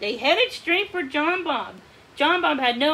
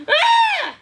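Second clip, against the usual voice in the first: high, loud scream of terror; terrified scream; 8 dB louder than usual. A narrator's reading. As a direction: scream for your life. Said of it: "screaming for his life".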